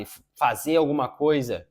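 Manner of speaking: normal talking voice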